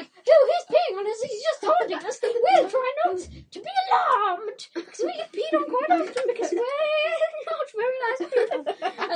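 high zombie voices. Pretend. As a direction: squeal it like taunted whining zombies. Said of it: "they'll be like".

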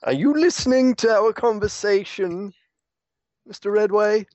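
pointed high challenge; suspicious. Acted. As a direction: call out the eavesdropper.

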